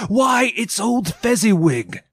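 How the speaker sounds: high, delighted exclamation; great excitement. A narrator's reading. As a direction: cry out in excited recognition. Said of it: "Scrooge cried in great excitement".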